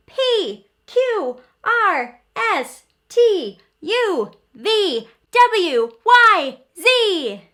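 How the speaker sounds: high, loud calling voices; letters calling names at bedtime; little letters calling out. A narrator's reading. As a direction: call each letter like a tiny excited character. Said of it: "each one calls out his name".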